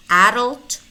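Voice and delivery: Adult as "AD-ult"; stress on first syllable. Shown as a demonstration. Teacher offers two choices, first-syllable stress in Adult. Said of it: "You can pick one".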